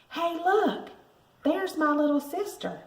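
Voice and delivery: high mocking brother's holler. A narrator's reading. Brother taunting his sister in the school hall, hollering. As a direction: call it out to embarrass her. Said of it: "he hollered".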